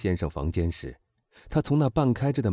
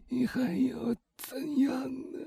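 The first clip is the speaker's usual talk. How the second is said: whispered, failing last words; hoarse dying woman; 6 dB quieter than usual. A narrator's reading. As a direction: gasp it out with no voice left. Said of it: "她声音嘶哑". (气若游丝、嘶哑地说)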